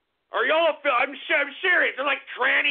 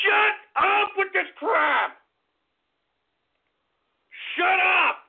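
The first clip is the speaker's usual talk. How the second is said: angry shout; 5 semitones higher than usual. Real, not acted. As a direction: slam the order down. Scolding the donors.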